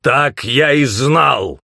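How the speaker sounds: loud, high, drawn-out roar; angry growl. A narrator's reading. Aahz smashes the table at the news, angry roar. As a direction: growl it slowly and forcefully. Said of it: "прорычал он".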